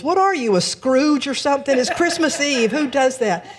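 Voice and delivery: high, loud jeering voice; mock heckling yell. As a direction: shout it like an outraged bystander. Heckler in her head.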